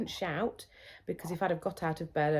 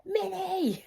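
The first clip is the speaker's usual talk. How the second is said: put-on high whiny toddler calling; much slower than usual. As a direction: imitate the toddler wanting her mini bed. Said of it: "She kept going".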